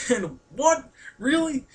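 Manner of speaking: high-pitched, laughing double take; amazed disbelief. Retold or imitated. Vlogger reacting to friends sharing the hugging plan, amazed disbelief. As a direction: pitch leaps up in incredulous questions.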